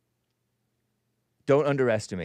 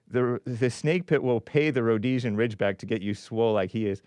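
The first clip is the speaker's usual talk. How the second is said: ordinary delivery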